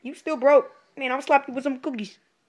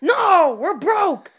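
higher and louder no; loud refusal; 7 semitones higher, 7 dB louder, much slower than usual. Acted. Cashier telling the customer they're broke, loud. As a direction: blurt out the refusal.

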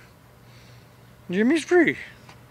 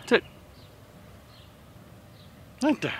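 usual speaking voice

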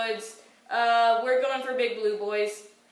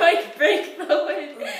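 higher, drawn-out words through laughter; laughing; 3 semitones higher, 4 dB louder, slower than usual. Real, not acted. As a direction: repeat your own slip while laughing. Laughing at own slip of the tongue.

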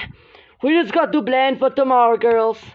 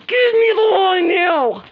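louder, higher, slow demand; 6 semitones higher, 5 dB louder, slower than usual. Pretend. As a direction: demand each word, loud and slow. Doll demands wand loudly.

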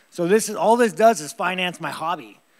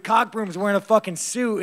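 ordinary talk, nothing marked

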